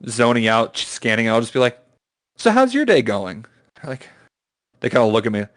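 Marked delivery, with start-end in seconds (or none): 2.4-3.4 bright high cashier small talk; cheery service-desk voice; customer service voice. Retold or imitated. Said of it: "customer service voice".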